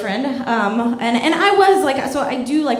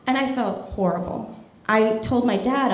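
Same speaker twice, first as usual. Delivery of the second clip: usual speaking voice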